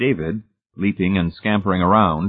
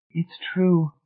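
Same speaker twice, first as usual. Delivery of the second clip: tearful; 9 semitones higher than usual. A narrator's reading. Marcia still tearful.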